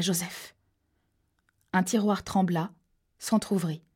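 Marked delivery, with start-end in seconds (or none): 0.0-0.5 whispered plea; silent prayer. A narrator's reading. Bound woman silently begging the ghost, whispered. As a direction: breathe the name like an inner prayer. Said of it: "pria Rita en son fort intérieur".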